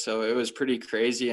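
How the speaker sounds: ordinary delivery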